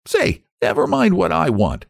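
put-on high, indignant boy's treble; angry retort. A narrator's reading. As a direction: snap back in a high, offended voice. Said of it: "he retorted angrily".

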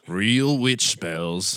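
slow, drawn-out preacher voice on "real witch spells"; mock preacher. Mocking the preacher for his cohost, slow and drawn out. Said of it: "He does it better than you".

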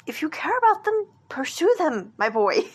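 put-on playful mom telling her boy to go for it; encouraging mother voice. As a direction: urge him on like a proud mom. Mom encouraging son about love.

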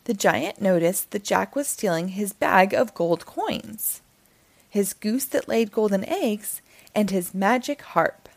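usual speaking voice

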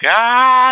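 high, loud retold war cry; a short high yell charging at death; mock battle yell. Podcaster acts out the old man charging with a high, loud yell. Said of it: "so he's just like".

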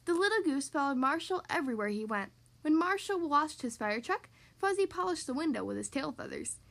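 ordinary delivery

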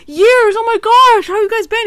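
overjoyed reunion, played up big; mock excited greeting. Robot friend greeting old pals, hammed-up gushing excitement. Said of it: "He didn't even go".

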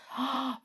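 sudden intake of breath; surprised gasp; sharp gasp. A narrator's reading. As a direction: gasp in happy realisation. Molly realises the rope's purpose.

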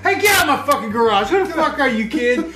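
laughing mock yell at a kid; hammed-up grumpy neighbor yelling; angry yell. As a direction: chase the kid off, half laughing. Acting angry garage owner.

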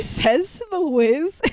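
ordinary delivery

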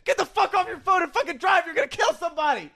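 high, angry ranting voice; high furious rant; retold yell. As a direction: scold the reckless driver. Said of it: "I said".

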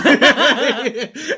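loud burst of laughter. Real, not acted. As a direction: crack up loudly. Big laugh alone.

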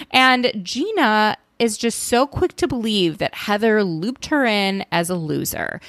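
ordinary talk, nothing marked

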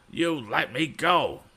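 bully screaming to be released. A narrator's reading. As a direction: scream it at him word by word. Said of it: "fairly screamed Andy".

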